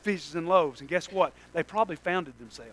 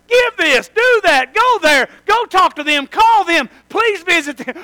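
loud, high string of orders; shouted commands; 11 semitones higher, 14 dB louder, slower than usual. Retold or imitated. Preacher shouting God's orders at the congregation. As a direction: bark out God's list of demands. God's demands voiced loudly.

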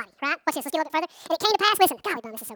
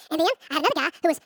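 normal talking voice

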